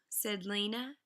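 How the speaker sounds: plain reading voice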